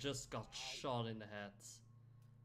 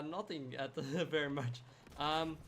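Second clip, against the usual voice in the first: usual speaking voice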